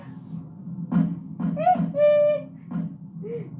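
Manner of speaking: shrieking burst of laughter; high squealing laugh. Real, not acted. Laughing at cursed avatar.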